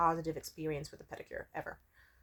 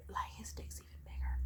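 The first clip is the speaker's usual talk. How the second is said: hushed, whispered aside; confiding whisper; 9 dB quieter than usual. Real, not acted. Vlogger confiding about a new lover, whispering. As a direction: whisper it like a naughty secret.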